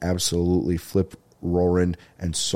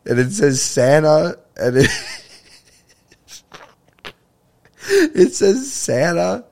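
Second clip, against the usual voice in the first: slow, high, words squeezed out through laughter; can barely get it out for laughing; laughing while telling; 9 semitones higher, 3 dB louder, slower than usual. Real, not acted. Host retells the Santa caller ID to listeners, laughing through it.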